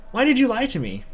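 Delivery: put-on high, hurt girlfriend; lightly voiced tearful girl. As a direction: plead why in her voice. Voicing Miku's hurt question.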